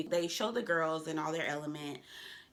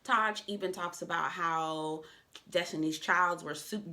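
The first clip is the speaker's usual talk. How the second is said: usual speaking voice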